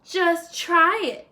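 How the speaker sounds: loud, high, slow dare; defiant shout. Acted. Third javelina defying Coyote, loud slow dare. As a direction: shout back a fearless challenge. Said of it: "shouted the third little javelina".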